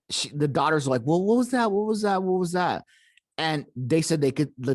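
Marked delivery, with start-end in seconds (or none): 1.1-2.8 high-pitched mock panic, repeating urgently; imitated panic. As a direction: voice the frightened daughters in a raised pitch. Daughters panicking, voiced by storyteller.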